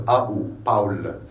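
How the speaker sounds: "Paul" as POWL after spelling A-U; German AU. Shown as a demonstration. Teacher spelling out the German vowels, AU diphthong. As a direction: sound both vowels in "Paul". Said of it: "En allemand, c'est un Paul".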